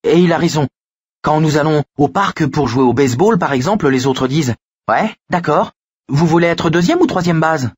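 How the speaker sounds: high-pitched, thin-voiced boy; thin but firm. A narrator's reading. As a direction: argue firmly in a small high voice. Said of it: "la voix menue et sifflante, mais le ton ferme". (petite voix aiguë, sifflante et décidée)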